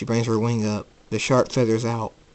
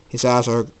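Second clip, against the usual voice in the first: usual speaking voice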